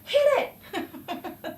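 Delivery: high order breaking into laughter; laughing order. Retold or imitated. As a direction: urge him on through giggles. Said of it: "I'm like".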